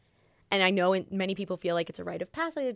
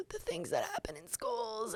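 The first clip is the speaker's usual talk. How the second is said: anxious dread; 3 semitones higher, 11 dB quieter than usual. Real, not acted. Anxious about sending son to school.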